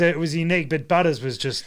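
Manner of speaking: ordinary talk, nothing marked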